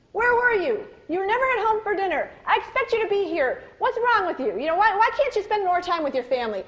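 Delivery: loud, high, scolding tirade at a late son; furious parent demanding where he's been; annoyed father yelling. Retold or imitated. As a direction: bark at him like a fed-up dad. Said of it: "he would get so annoyed".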